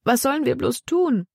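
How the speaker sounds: put-on high, lightly wailing girl; a lightly voiced lament; mild despair. A narrator's reading. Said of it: "jammerte Libby".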